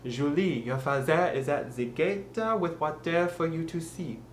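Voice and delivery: deliberate French pronunciation of English; French accent. Shown as a demonstration. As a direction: put on careful French-accented English, sip as "seep". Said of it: "with the French pronunciation".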